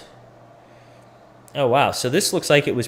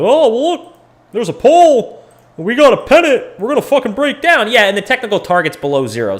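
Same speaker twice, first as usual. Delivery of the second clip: high mocking voice of a clueless trader, much louder; 11 semitones higher, 6 dB louder than usual. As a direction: squeal it like a know-nothing hyping the chart. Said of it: "If you had someone that didn't know what they're talking about".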